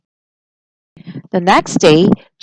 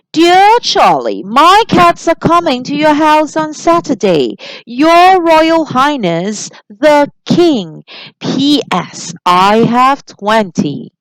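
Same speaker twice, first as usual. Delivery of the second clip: regal king voice; 6 semitones higher, 3 dB louder, slower than usual. Acted. Reads the king's letter.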